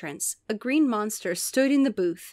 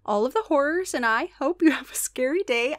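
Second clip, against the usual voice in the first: higher, rusty Scottish monster; amateur Scottish lilt, pitched up; Scottish accent; 4 semitones higher than usual. Acted. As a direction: welcome guests in an attempted Scottish accent. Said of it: "I'm gonna try to do Scottish".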